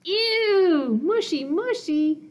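high, loud disgusted ew; grossed out. Acted. Monster grossed out by the talk of love. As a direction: squeal mushy mushy like it's slime.

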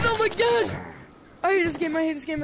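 high, loud exasperated shout; a gamer raging at a scary moment; frustrated yell. Real, not acted. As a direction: vent your anger at the game. Frustrated after a scare.

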